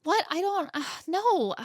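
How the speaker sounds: high, halting, flustered imitation; mock baffled squeak; flustered protest. Acted quote of Tara caught off guard.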